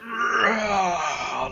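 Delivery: high, drawn-out roaring heave; manly grunt of effort. Acted. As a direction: roar out a big push. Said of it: "like, a really manly push".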